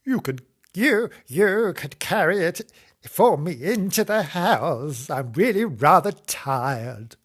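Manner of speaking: put-on drawn-out old lady request; old woman voice. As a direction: plead slowly as a tired old woman. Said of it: "this is my old woman voice".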